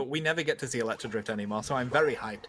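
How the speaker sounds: flat, deadpan hype, quick and low; monotone. Real, not acted. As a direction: say you're hyped with zero energy. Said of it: "the calmest voice I have ever seen you do".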